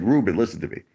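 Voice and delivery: usual speaking voice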